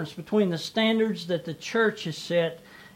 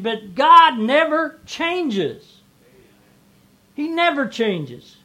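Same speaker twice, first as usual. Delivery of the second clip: loud, slow, rising preacher's cry; preaching at full force; 5 semitones higher, 4 dB louder than usual. Acted. Pastor preaching to the congregation, fervent and slow. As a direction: hammer it home from the pulpit.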